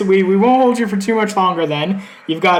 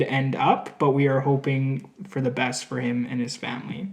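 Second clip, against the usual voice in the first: lower, soft, measured newsroom voice; news anchor read; 4 semitones lower, 4 dB quieter than usual. Acted. Anchor reports back to viewers after the interview, somber and measured. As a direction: sign off the story like a solemn anchor.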